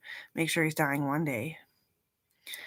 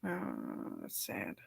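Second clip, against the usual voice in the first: mock sad murmur; 9 semitones lower, 11 dB quieter, much slower than usual. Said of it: "I was like".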